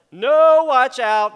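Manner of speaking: high, loud alarm call of the heart; loud urgent warning voice; sudden warning shout. Pretend. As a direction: cry out a warning, no, stop. Inner voice warning against bad choice.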